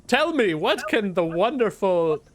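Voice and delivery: high, slow, self-important drawl; preening high-pitched humanoid; Tech's haughty voice. Acted. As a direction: grandly offer your services. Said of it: "And she goes".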